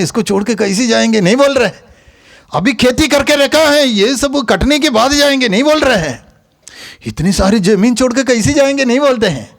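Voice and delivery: high-pitched, insistent preaching; high, ringing exhortation; preaching at full force. Real, not acted.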